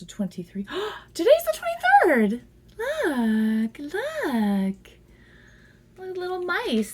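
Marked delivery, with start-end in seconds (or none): 1.1-2.4 sudden high, loud gasp; surprised high gasp, then louder; gasp of surprise. Real, not acted. Notices date coincidence. 2.8-6.9 cooing high voice. Real, not acted. Cooing at card.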